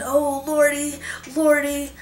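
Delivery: high, loud frightened moan; terrified moan. A narrator's reading. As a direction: moan it out in terror. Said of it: "the gravedigger moaned".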